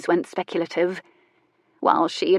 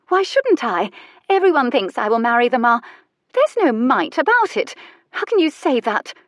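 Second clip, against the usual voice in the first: high, loud, wounded protest; indignant outburst, pitch leaping up; stung indignation; 6 semitones higher, 4 dB louder than usual. A narrator's reading. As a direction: fire back as if a raw nerve was hit. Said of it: "A nerve, a terribly raw one, had apparently been struck".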